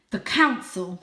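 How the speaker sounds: loud, contemptuous; spat. Acted. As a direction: spit the words out. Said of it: "spat Father".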